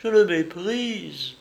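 aigu, lent, plein de dégoût; lâcher son mépris dans un cri; mépris furieux. A narrator's reading. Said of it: "s'écria Julie hors d'elle-même". (Julie, hors d'elle, avoue son mépris)

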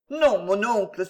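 ordinary delivery

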